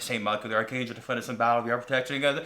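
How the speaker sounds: soft, rattled-off prayer; praying. Retold or imitated. Trapped tourist begging a saint's protection, praying softly. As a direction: recite it low like a rote prayer. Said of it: "I start praying".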